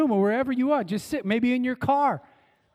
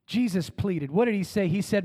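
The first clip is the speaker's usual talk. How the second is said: normal talking voice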